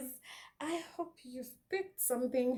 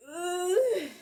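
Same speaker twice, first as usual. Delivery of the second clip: high, loud frustrated ugh; exasperated groan; 8 semitones higher, 6 dB louder than usual. Real, not acted. Exasperated groan about selfish guys.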